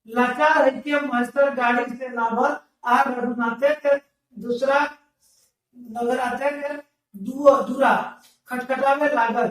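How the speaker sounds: slow sing-song text reading; lilting recital of the text; reading aloud. Acted. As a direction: chant the passage like a class reader. Reads the dialect text aloud.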